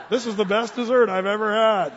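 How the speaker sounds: laughing while quoting the husband; retelling the joke while laughing; laughing through the punchline. Retold or imitated. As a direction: chuckle through the line. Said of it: "he said".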